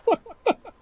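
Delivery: high squeal of laughter. Real, not acted. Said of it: "Like, strip naked".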